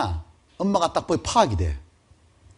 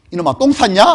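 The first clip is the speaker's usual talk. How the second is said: scolding mother; 5 semitones higher, 6 dB louder than usual. Retold or imitated. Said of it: "엄마가 와서 뭐라 했냐면".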